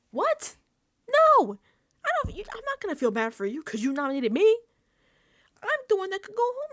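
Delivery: high, loud disbelieving no; indignant outburst. Real, not acted. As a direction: blow up at the crying HOH. Indignant rant at HOH.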